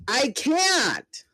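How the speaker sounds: the same fighter cries out again in despair; desperate shout. Acted. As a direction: yell it out in despair. Panicked cries in the battle.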